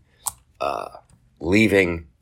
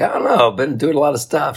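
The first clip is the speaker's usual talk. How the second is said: nervous dodge; 8 semitones higher, 3 dB louder than usual. Retold or imitated. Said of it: "I'm like".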